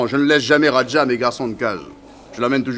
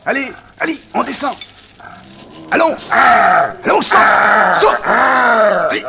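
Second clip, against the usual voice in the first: high, loud commands to the animal; calling commands; 10 semitones higher, 6 dB louder, slower than usual. Acted. Tamer ordering his tiger down out of the cage, loud and high. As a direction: urge the big cat to jump.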